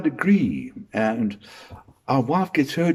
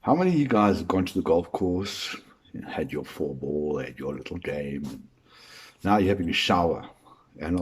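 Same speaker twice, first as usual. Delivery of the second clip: normal talking voice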